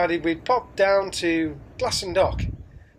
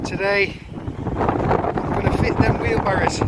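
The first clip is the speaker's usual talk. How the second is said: shouting over wind; 4 dB louder than usual. Real, not acted. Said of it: "Sorry about the wind noise".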